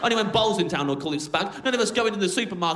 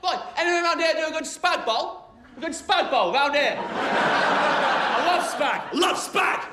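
high, boorish Brit-abroad hollering; barking at friends; 9 semitones higher, slower than usual. Acted. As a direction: call out to a mate across the street, high and coarse. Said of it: "barking at our friends".

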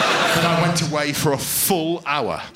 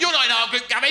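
raised-pitch telling-off; exasperated teacher; 8 semitones higher, faster than usual. Acted. Teacher telling off a pupil, exasperated. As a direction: scold him in a higher voice.

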